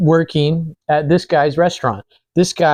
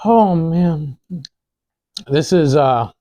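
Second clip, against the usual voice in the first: mouth full; 2 semitones higher, much slower than usual. Real, not acted. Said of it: "Why not eat food while I'm talking".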